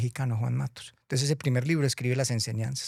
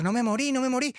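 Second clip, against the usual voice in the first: high excited shout; 11 semitones higher than usual. Acted. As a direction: yell it with relief and surprise. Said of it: "empezó a gritar". (el patico gritando de alegría, agudo)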